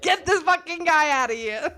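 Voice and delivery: hammed-up outrage through laughter; laughing mock outrage. Acting outraged guest, laughing.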